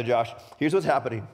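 ordinary talk, nothing marked

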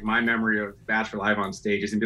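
normal talking voice

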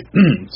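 clears a hoarse throat; short rough throat clear. Real, not acted. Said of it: "sorry, I'm losing my voice here".

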